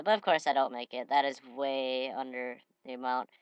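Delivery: ordinary delivery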